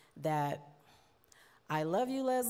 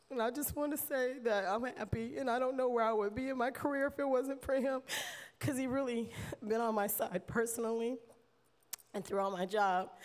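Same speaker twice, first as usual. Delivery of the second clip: higher, tear-choked voice; holding back tears; 5 semitones higher than usual. Real, not acted. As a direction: fight back tears as you speak. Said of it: "I never cry".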